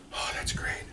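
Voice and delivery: very soft, voiceless whisper; acted under-the-breath response; whispered reaction. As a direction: whisper it as a private aside.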